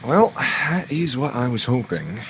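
a wry, satisfied in-character reply; a touch of a put-on gentleman voice; lightly voiced character. Character banter in voice.

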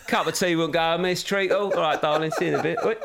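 cheeky geezer calling oi love; imitating kids as lads. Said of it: "Oi love".

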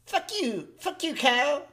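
high-pitched cartoon kid impression; high cartoon voice, swearing at a pal; Cartman.

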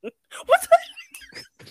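high, loud squawk of disbelief; outraged. Real, not acted. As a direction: burst out in high indignation. Mock outrage at being tricked.

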